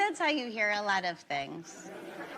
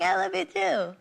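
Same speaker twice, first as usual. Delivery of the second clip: higher, sing-song mock reply; mock sweet reply; 6 semitones higher than usual. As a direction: answer with a sugary mock tone. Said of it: "And I was like".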